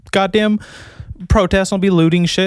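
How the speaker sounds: ordinary delivery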